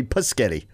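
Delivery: "Pusketty" for spaghetti, puh-SKET-ee; friend's mispronunciation. Retold or imitated. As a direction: say "Pusketty" with P and S swapped. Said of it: "couldn't say spaghetti".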